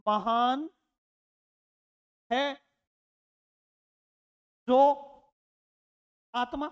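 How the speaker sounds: each word said apart with long pauses; separated slow words. Shown as a demonstration. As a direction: drag the phrase out word by word. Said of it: "और इसका मैंने क्या बना दिया?".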